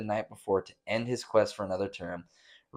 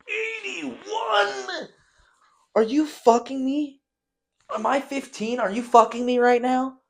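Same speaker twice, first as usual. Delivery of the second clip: high, loud disbelieving shout; shrill stunned yell at the number; shocked outcry; 6 dB louder, slower than usual. Real, not acted. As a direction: cry out in disbelief. Shocked at Biden's age.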